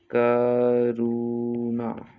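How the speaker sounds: very slow, stretched Karuna; drawn-out syllables. Shown as a demonstration. Teacher dictating to students, Karuna stretched syllable by syllable. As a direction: hold each vowel of Karuna long.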